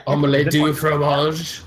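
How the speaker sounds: put-on hammy French accent; mock French accent joke. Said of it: "I can do a French accent".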